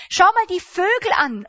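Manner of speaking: loud, high, urgent call to look; Jesus urging worried listeners to look at sparrows, calling out. Acted. Call it out like pointing at the sky. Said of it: "Und Jesus sagt".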